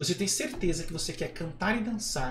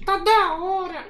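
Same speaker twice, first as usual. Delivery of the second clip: high, loud, drawn-out puppet voice; slow high-pitched dummy; Kleber; 12 semitones higher, 6 dB louder, much slower than usual. Acted. Puppet praising the podcast, high puppet voice. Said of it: "acha uma voz que pareça com o boneco".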